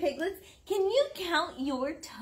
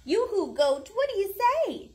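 high, louder fast call; 6 semitones higher, 5 dB louder, faster than usual. Acted. Mother reading aloud, calling out to the goat. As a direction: holler hello to the goat.

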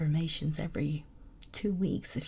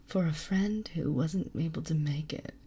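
ordinary delivery

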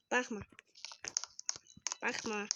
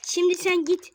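raised, higher bossy order; louder bossy order to the friend; bossy call; 5 semitones higher, 6 dB louder than usual. Real, not acted. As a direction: tell him to go, loudly. Bossing friend in hide and seek.

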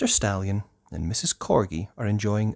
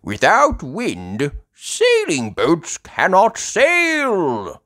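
slow, loud, raised explaining voice; Grandpa Pig; 12 semitones higher, 8 dB louder, slower than usual. Acted. Grandpa Pig explaining about wind, slow loud voice. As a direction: spell it out slowly and loudly. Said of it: "says Grandpa Pig".

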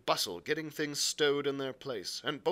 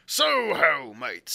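loud drawn-out sailor's hail, falling on "mates"; hailing shipmates; 10 dB louder, much slower than usual. A narrator's reading. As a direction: call out boldly across the deck. Cook hails the men on deck.

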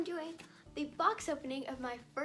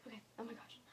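whisper; 9 dB quieter, faster than usual. Real, not acted. Whispering in awe at a hand.

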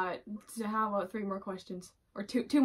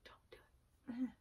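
whispered pain; 20 dB quieter than usual. Real, not acted. Whispered pain under clothespin.

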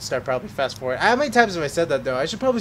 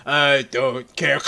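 put-on slow, loud drunken drawl; imitating drunk Joe; 2 semitones lower, 5 dB louder, much slower than usual. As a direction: drag the words out like a tipsy guy. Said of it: "it's so funny the way he does".

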